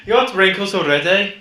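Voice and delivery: high, loud Midlands mock scolding; put-on Midlands accent. Killjoy pointing out wrinkles, put-on Midlands accent. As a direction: shout it in an exaggerated Midlands accent. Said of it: "do the whole podcast in that accent".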